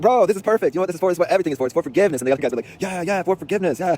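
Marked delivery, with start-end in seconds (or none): 0.0-2.2 high, loud rush of enthusiasm; excited exclaiming, easing slightly at the end; elated. Retold or imitated. Acting out excited greeting to former opponent. 2.8-4.0 high, drawn-out mock crowd cheer; slow, high-pitched rallying cry; imitated cheering. Imitating the friends cheering along.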